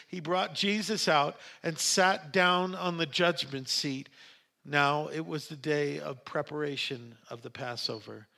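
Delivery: ordinary delivery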